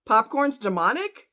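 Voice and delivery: ordinary talk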